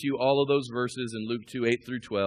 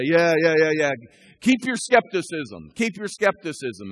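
loud, higher mock encouragement; dismissive cheering-on; 5 semitones higher, 7 dB louder than usual. Skeptic friends urging on the sick man, loud mock encouragement. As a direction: egg him on, punchy and raised. Voicing friends egging him on.

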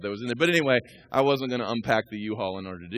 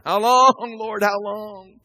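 high, loud, drawn-out plea; crying out; 10 semitones higher, 9 dB louder, much slower than usual. Acted. Saints under the altar pleading to God, crying out. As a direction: wail it up to heaven, stretching "long". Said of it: "They're crying out".

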